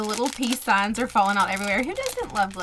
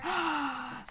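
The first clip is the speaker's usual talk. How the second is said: delighted gasp; 4 semitones higher, 9 dB quieter than usual. Real, not acted. Opening the matching calculator.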